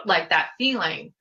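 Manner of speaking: ordinary talk, nothing marked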